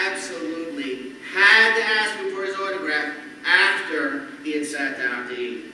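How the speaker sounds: normal talking voice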